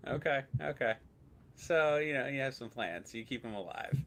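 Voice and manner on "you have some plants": normal talking voice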